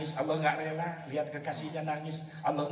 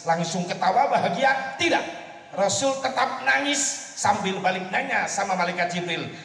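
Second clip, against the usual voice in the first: ordinary talk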